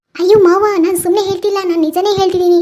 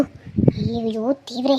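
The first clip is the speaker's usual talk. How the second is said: dismayed sigh; 4 semitones lower, 9 dB quieter, much slower than usual. Acted. Dismay at trolled videos.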